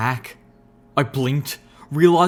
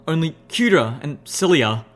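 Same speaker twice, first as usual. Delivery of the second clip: ordinary delivery, nothing marked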